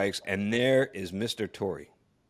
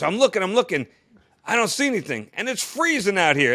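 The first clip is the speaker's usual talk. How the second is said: exasperated complaint; 8 semitones higher, 4 dB louder than usual. Retold or imitated. Torre complaining of cold, no sight.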